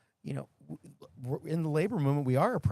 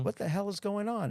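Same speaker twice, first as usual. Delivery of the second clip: higher-pitched, mock-baffled inner voice; retold bewilderment; 9 semitones higher than usual. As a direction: act out total bafflement. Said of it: "I'm thinking to myself".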